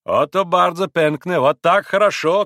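loud, high cry of approval; shouted approval. A narrator's reading. Second Pole applauds his companion's toast, shouting. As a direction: cheer the toast on. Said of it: "крикнул другой пан".

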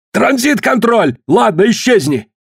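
exasperated high-pitched command; impatient shout. A narrator's reading. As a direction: cut in and dismiss them, pitch thrown up. Collins cuts in, impatiently ordering.

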